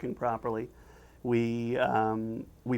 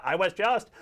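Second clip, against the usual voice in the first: higher, exasperated interrupted protest; getting angry; 7 semitones higher than usual. Acted. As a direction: try to explain over the din, irritated. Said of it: "now Squidward's getting angry".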